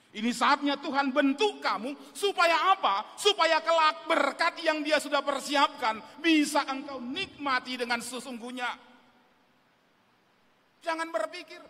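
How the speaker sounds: loud, driving exhortation; forceful preaching. Real, not acted. Preacher urges the young congregation not to complain, loud exhortation. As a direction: drive the promise home at full force.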